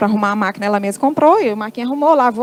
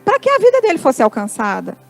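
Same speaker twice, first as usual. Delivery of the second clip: preaching; 3 semitones higher than usual. Acted. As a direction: draw out each phrase to move the congregation. Testimony turns to exhortation.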